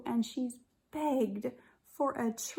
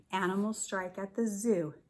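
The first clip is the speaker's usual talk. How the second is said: plain reading voice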